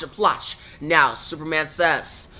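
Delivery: ordinary delivery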